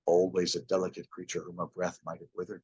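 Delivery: low, soft, otherworldly voice; a quiet, solemn spirit; ghost's voice. A narrator's reading. The ghost speaks.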